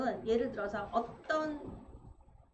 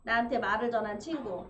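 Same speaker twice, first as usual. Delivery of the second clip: ordinary talk, nothing marked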